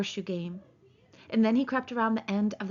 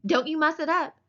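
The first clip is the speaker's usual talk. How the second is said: higher, light playful warning; Curly's wife; 7 semitones higher, 4 dB louder than usual. Acted. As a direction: tease him not to mess it.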